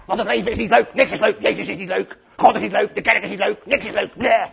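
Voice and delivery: loud, hammed-up sulky moaning; mock grouchy complaining; grumpy. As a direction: whine like a bleating, sulking grump. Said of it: "maar dan heel zagrijnig".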